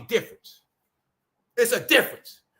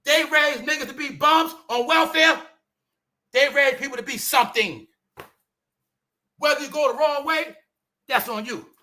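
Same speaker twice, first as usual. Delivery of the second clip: ordinary talk, nothing marked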